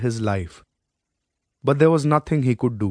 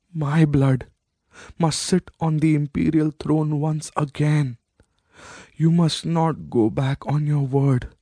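a lightly voiced, higher old queen, rasping her last demands; dying old woman; 3 semitones higher than usual. A dying mother binds her son to his promise, lightly rasped. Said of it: "her voice the feeble and hoarse rasp".

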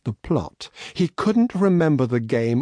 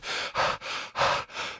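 breathless sigh; 10 dB quieter than usual. Acted.

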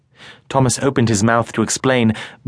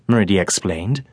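normal talking voice

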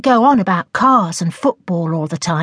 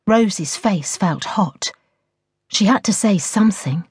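plain reading voice

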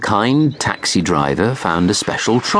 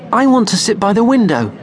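high, louder whiny want; demanding child; 13 semitones higher, 4 dB louder than usual. A narrator's reading. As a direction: insist like a small boy.

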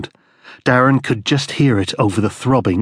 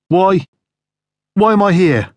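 pleading; 9 semitones higher, 4 dB louder than usual. Acted. Captive asking why he's held.

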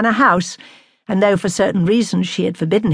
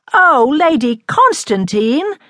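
slow, higher-pitched cry of dismay; 7 semitones higher, 4 dB louder, slower than usual. A narrator's reading. Young astronomer to the lady who forgot, dismayed cry. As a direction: draw it out in appalled reproach. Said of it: "he cried in dismay".

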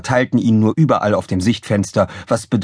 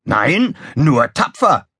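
bold retort, pitched up; Krawall, bold; 6 semitones higher, slower than usual. A narrator's reading. Officer rejects being called crazy, bold retort. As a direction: answer boldly, with bravado. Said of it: "erwiderte Krawall kühn".

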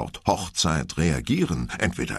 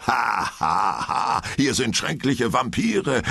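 higher, lightly hammed-up theatrical vampire; mock vampire actor; 6 semitones higher, slower than usual. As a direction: ape the ride actors' spooky laugh. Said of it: "imitierte Viktor den Tonfall der Schauspieler".

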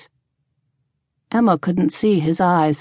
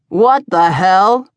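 slow, louder, indignant man's demand; 5 dB louder, slower than usual. A narrator's reading. Barfly objecting to the tavern keeper, demanding. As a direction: demand it heavily, word by word. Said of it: "Rufus demanded".

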